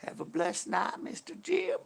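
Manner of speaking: high-pitched Texas drawl, lifting sharply on the name; Texas accent. Acted. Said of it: "I'll say that in a Texas accent".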